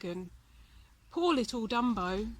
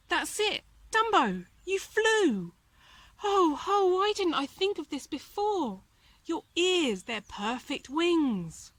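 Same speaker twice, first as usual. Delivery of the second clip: much higher and louder, thrilled; excited mouse; 6 semitones higher, 5 dB louder than usual. A narrator's reading. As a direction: shout it as a joyful discovery. Timothy excited discovery.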